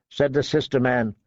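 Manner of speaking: ordinary delivery, nothing marked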